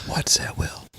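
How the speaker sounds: unvoiced hushed question; whispering. Real, not acted. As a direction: whisper it like a secret. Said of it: "I'm whispering".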